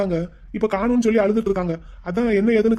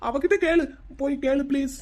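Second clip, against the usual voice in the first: high-pitched, panicky protest; flustered denial; 6 semitones higher than usual. Acted. Young man begs her to question the visitor, flustered. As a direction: deny it and plead nervously. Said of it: "நீ ஏன் இப்ப இவ்வளவு பதற".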